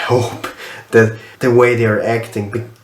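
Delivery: ordinary delivery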